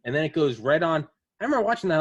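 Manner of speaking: normal talking voice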